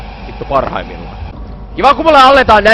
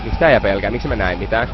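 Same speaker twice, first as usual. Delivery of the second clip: usual speaking voice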